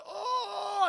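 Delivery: high, loud "oh" of agony; mock pained cry. Jax as his arms rip off, mock pained cry. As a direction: cry out in hammed-up pain.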